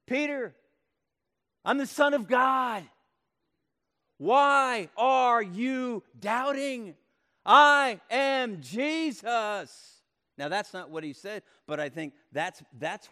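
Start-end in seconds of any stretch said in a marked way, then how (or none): 0.0-10.0 loud, high, slow declaration; emphatic proclamation. Acted. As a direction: proclaim it with full force. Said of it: "he wanted to say".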